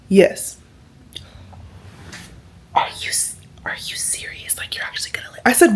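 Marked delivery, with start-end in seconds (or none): 2.7-5.4 hushed secretive coworker whisper; whispered quote. Retold or imitated. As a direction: lean in and whisper like it's a secret. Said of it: "Why are we whispering?".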